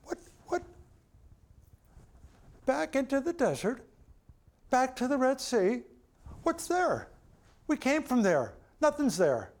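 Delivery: imitating the grumbling people's disbelief; grumbling Israelites. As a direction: whine like a crowd that can't believe it. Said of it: "This is what they must have said".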